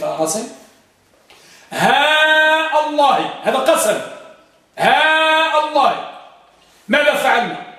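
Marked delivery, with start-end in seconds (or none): 1.7-3.3 higher, very drawn-out "ها", then "الله" with a glottal stop; long vowel and hamza. Shown as a demonstration. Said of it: "وضعنا المد على الهاء". 4.8-6.1 teacher's slow model, long "ها" before "الله"; long vowel and hamza. Shown as a demonstration. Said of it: "وضعنا المد على الهاء".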